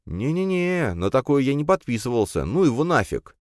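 high-pitched, protesting no-no-no; emphatic refusal. A narrator's reading. Refusing his friend's scheme, high and protesting. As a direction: back out of it, voice jumping high.

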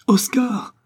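mother warns her son of danger; mother's warning cry. A narrator's reading. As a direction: cry out to warn the boy. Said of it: "ne put s'empêcher de crier la mère".

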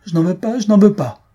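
high, loud tantrum; angry. A narrator's reading. Daughter stamps and refuses her mother, angry. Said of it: "trépigna de colère en répétant".

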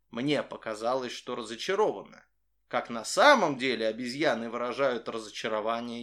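ordinary delivery

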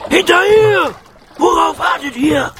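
very high, loud captain's shriek; Knurrhahn ranting. A narrator's reading. Pirate captain drives crew overboard, ranting shrilly. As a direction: rant at the crew from up on the railing. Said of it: "zeterte Knurrhahn oben an der Reling".

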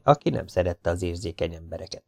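usual speaking voice

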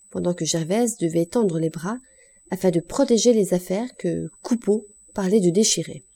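plain reading voice

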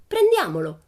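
high, loud, eager call to action; exclaiming. A narrator's reading. Pippi urging her friends to take it, exclaiming. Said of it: "esclamò Pippi".

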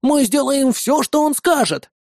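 put-on slow, high-pitched child's promise; children's cries. A narrator's reading. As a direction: pledge it like an eager kid. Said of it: "Тут же понеслись крики". (высокий детский возглас)

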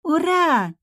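put-on high, ringing little-girl cheer; gleeful child. A narrator's reading. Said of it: "Соня звонко засмеялась".

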